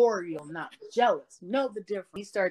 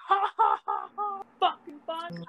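mocking laugh; 11 semitones higher than usual. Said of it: "I can't believe I was like".

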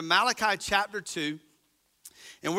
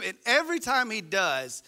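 ordinary talk, nothing marked

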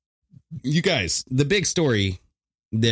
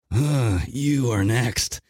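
slow menacing death voice; mock grim reaper threat, very slow; much slower than usual. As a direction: loom over them like death. Pointing sickle, playing death.

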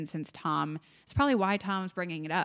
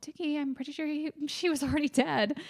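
higher, light imitation of Marge; lightly voiced girlfriend, unbothered; mock Marge voice; 9 semitones higher than usual. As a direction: play her as puzzled and mildly dismissive.

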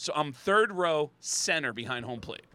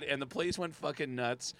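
ordinary delivery

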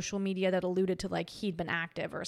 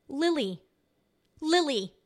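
firm, emphatic Lily, louder and higher on the repeat; insistent; 5 semitones higher, 5 dB louder, slower than usual. Retold or imitated. Telling the doctor her own name, pointing at her chest, insisting. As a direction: stamp out Lily, Lily as if stating the obvious.

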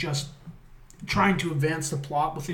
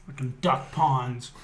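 ordinary talk

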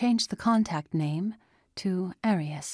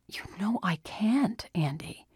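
hushed; 3 semitones higher than usual. A narrator's reading. Father telling his daughter he can't help, whispered. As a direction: breathe it out, defeated.

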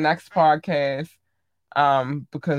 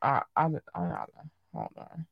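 quiet; 9 dB quieter, faster than usual. Real, not acted. Distracted, stops to loot.

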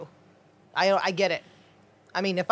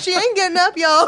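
high, loud laughing call; 9 semitones higher, 8 dB louder than usual. Pretend. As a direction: play along, cracking up. Joining the joke.

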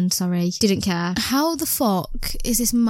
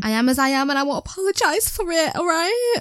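defiant; 7 semitones higher than usual. Real, not acted. Defiant no-apology declaration.

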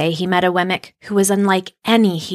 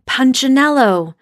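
strong high call across the shop; 6 semitones higher, 4 dB louder than usual. A narrator's reading. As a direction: call his name out firmly. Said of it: "The voice was deep and strong".

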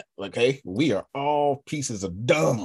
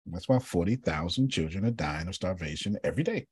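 ordinary talk, nothing marked

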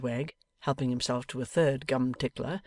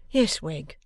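mildly meek reply; a touch of meekness; 3 semitones higher, 3 dB louder than usual. A narrator's reading. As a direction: answer submissively. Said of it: "said Mister Boffin meekly".

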